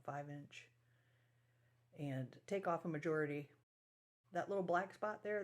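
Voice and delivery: usual speaking voice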